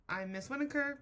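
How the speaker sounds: ordinary talk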